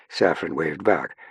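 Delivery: plain reading voice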